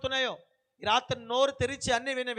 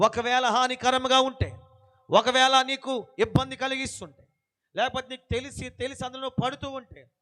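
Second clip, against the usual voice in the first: louder, pressing preacher's warning; forceful preaching; 5 dB louder than usual. Acted. Preacher warning brothers and sisters about Satan, forceful preaching. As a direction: press the warning home from the pulpit.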